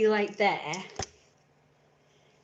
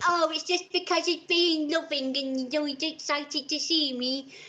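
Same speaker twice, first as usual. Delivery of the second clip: a lightly mocked fond dog owner; mock owner excusing her jumping dog; mock doting owner; 5 semitones higher than usual.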